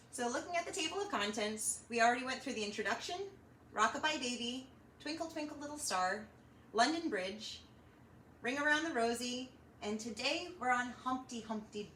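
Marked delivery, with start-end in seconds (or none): none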